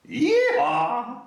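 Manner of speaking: high loud country whoop; cowboy yee-haw. Acted. Reading to children, a loud high yee-haw whoop.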